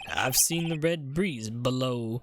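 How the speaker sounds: put-on southern cowboy accent. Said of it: "I've never met someone who talks like that".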